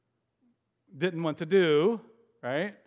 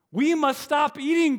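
high, loud cry from Toad; cried out; 12 semitones higher, 7 dB louder than usual. Acted. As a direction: Toad crying out in alarm. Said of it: "cried Toad".

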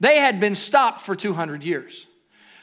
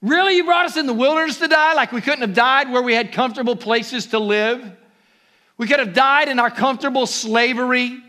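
high, sarcastic, whining Israelites; whiny complaint; 5 semitones higher, 4 dB louder than usual. Retold or imitated. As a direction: whine it like ungrateful complainers. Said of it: "and you can hear this".

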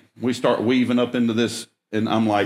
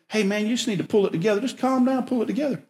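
much higher, softer and fast, brushing it off; dismissive advice; 9 semitones higher, 4 dB quieter, faster than usual. Retold or imitated. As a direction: toss off easy advice like it's nothing. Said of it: "would just say".

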